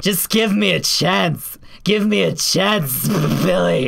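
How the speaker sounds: hammed-up stammering plea; Rebecca flirting. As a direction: plead desperately, stuttering the name. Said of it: "if Rebecca was the one flirting".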